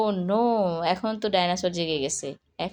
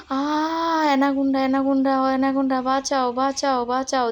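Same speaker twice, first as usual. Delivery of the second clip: higher, louder pretend cries for help; mock panic; 5 semitones higher, 4 dB louder than usual. Toy people crying out at the anaconda, higher and louder mock panic. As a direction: play the toy victims screaming for rescue.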